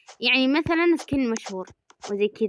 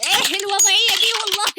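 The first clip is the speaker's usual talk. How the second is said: much higher, louder mock-Egyptian question; Egyptian accent; 11 semitones higher, 5 dB louder than usual. Gamer choosing a pose, high Egyptian accent. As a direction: put on a high Egyptian streamer voice. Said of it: "أبي أسوي نفس التيس عنده".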